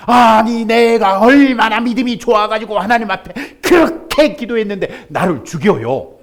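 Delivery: loud, high protest to God; dead man argues with God, loud and aggrieved; indignant complaint. Acted. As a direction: demand an explanation, aggrieved. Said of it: "막 따지는 거예요".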